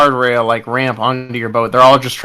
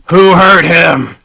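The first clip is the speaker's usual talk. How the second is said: louder, drawn-out angry demand; yelling; 4 semitones higher, 5 dB louder, slower than usual. Acted. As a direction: yell it out slowly as a demand. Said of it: "You heard him yelling".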